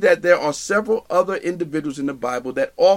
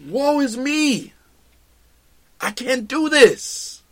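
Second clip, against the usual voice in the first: despairing lament; 6 semitones higher, slower than usual. Retold or imitated. Voicing despairing Paul.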